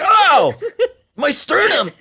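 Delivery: high, loud comic yelp; hammed-up injured victim wail; mock pained cry. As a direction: cry out in pretend agony. Acting the choking victim getting hurt.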